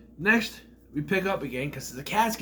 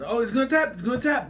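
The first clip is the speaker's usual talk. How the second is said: mock commentator excitement; 10 semitones higher, 5 dB louder, faster than usual. Excited call of near submission.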